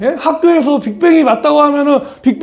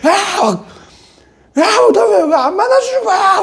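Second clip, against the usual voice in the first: angry shout at God; 10 semitones higher than usual. Retold or imitated. Teen at retreat yelling at God, angry crying shout. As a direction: yell it at God, near tears. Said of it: "막 화가 나니까 막 하나님한테 막".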